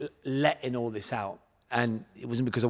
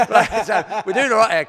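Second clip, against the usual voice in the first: laughing; 9 semitones higher, 14 dB louder than usual. Real, not acted. Laughing after recalling coach's remark.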